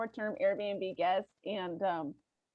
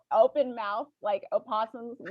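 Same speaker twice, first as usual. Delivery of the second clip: ordinary talk, nothing marked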